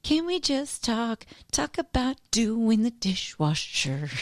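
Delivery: slow, drawn-out teasing plea; mock sing-song plea. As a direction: stretch it out like a nagging mom. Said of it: "The girls don't like it when I start saying that".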